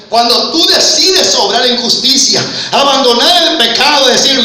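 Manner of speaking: loud, driving preacher's cry; full-force preaching. Acted. Preacher promising the congregation grace and victory, full-force preaching. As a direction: build with repeated, hammered promises.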